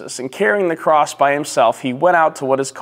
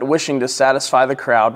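ordinary talk

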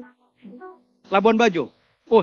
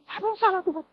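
high, soft plea to God; re-enacted helpless plea; plaintive question; 12 semitones higher, 6 dB quieter, faster than usual. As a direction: ask it small and bewildered. Retells questioning God in grief.

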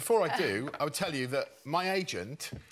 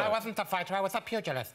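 Chris Eubank impression; 3 semitones higher, faster than usual. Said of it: "Say pugilist a few times".

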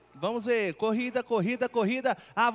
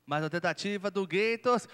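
ordinary talk

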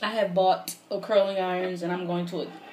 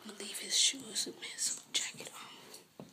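whispering; 3 semitones higher, 14 dB quieter than usual. Real, not acted. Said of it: "I can talk a little louder now".

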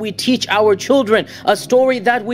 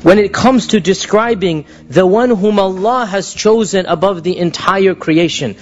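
full force preaching; 3 semitones lower than usual. Acted.

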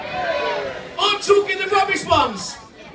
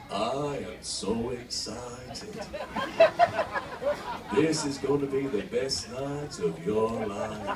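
very low, slow, monotone mock seduction; 8 dB quieter, slower than usual. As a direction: drone it with no excitement at all. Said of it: "He'd be like that".

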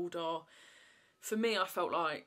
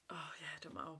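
breathy dismissive whisper to herself; whispered aside; 2 semitones lower, 10 dB quieter, faster than usual. Real, not acted. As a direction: drop to a whisper and let it go. Gives up explaining, aside.

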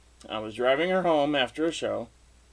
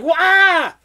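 loud, very high mock scream; comic high-pitched shriek; startled yelp, acted; 9 dB louder than usual. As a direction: yelp in exaggerated shock. Acts out his shock at seeing her.

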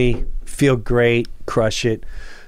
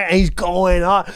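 ordinary talk, nothing marked

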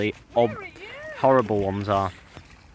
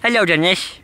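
greeting the dog; 6 semitones higher, 6 dB louder than usual. Real, not acted.

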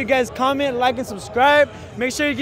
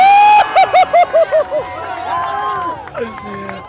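high loud laughing whoop; wild laughing shout; 5 dB louder than usual. Real, not acted. Winner finishes challenge.

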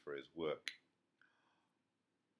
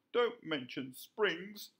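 high fox voice; 13 semitones higher, 9 dB louder, much slower than usual. A narrator's reading. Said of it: "said the fox".

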